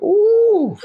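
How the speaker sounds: loud, very high-pitched ooh; sudden high wail of embarrassment; cringing ooh. Retold or imitated. Actor recalls being told to drop the accent, cringing ooh.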